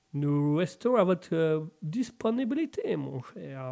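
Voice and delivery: slow mock-English French, fading soft; polite lord with a bad English accent. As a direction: offer it courteously in put-on English tones. English lord character answers the host.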